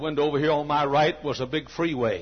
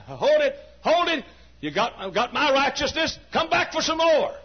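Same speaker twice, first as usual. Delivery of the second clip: much higher and louder command; urgent call; 12 semitones higher, 5 dB louder than usual. Acted. God calling the believer back for more, urgent. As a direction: stop them and call them back.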